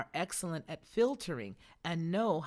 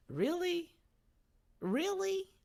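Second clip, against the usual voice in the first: very high rising disbelief; skeptical reaction; 13 semitones higher, slower than usual. Acted. Listener answering obvious flattery, mock incredulous. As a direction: squeak it up like a doubting question.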